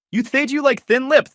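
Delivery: high, loud slurred lisp with th for s. Acted. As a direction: mangle every s into th. Nicole lisping with swollen lips.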